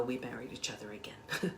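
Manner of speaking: soft whispered aside; whispered confession. Real, not acted. Speaker confides to audience her remarriage, softly whispered. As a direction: let it slip out like a happy secret.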